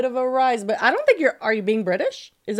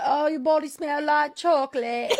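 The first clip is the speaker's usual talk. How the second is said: higher-pitched mock British accent; imitated British accent; 6 semitones higher than usual. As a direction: put on a British accent for the quote. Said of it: "My brother always used to play".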